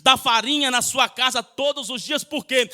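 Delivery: pregação fervorosa; fervent preaching. Acted. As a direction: preach it with building fire, half sung. Preaching at full force, sung cadence.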